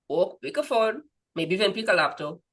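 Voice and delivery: ordinary talk, nothing marked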